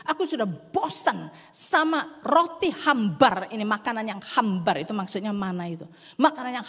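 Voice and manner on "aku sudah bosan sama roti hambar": slow, louder bored complaint; fed-up whine. Acted. People complaining about the bland bread, fed up and slow. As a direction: drag it out, sick of the bread. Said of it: "mereka berkata gini".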